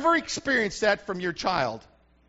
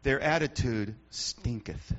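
mock-solemn low, slow stinketh with a lisped th ending; the preacher jokingly translating into King James speech; King James style; 12 semitones lower, 6 dB quieter, slower than usual. Shown as a demonstration. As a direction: ham up Bible-speak on stinketh. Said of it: "real fans of the King James".

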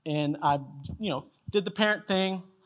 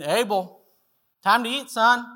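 re-enacted call, lifting on the last words; mild holler to a child outside; 5 semitones higher, 5 dB louder than usual. Dad opens the door again to call his son to dinner, hollering. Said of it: "hollered at him down in the yard".

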